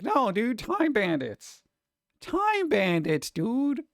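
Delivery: pitching the show like a clueless exec, slowly; mock studio exec. To the chat, mocking the studio pushing Time Bandits, high and eager. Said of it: "but they're like".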